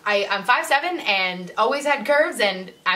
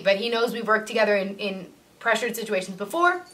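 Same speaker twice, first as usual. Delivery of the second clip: normal talking voice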